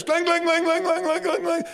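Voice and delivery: high, loud ringing clangs; clanging imitation. Teller mimicking pipes clanging like alarm bells.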